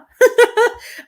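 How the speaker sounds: high, loud burst of laughter; embarrassed laugh. Real, not acted. Reader laughing at herself for tearing up, loud high laugh.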